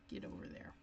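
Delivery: whisper. Acted. Talking to a stuck die piece.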